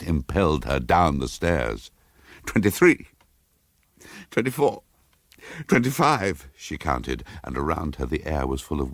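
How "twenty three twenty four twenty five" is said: fearful counting. Acted. Counting steps down in terror.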